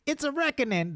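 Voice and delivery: high squeaky Cat Williams impression. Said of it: "The Cat Williams voice".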